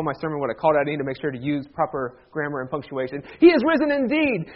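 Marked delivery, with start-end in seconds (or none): 3.4-4.5 high, ringing congregational reply; formal Easter response, pitched up; liturgical response. Shown as a demonstration. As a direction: answer as a proclaiming congregation. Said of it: "you respond appropriately if you know the response".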